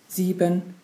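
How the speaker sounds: plain reading voice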